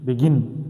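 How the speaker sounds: short light Be, weight on gin in Begin; stress on GIN. Shown as a demonstration. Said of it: "My stress is on gin".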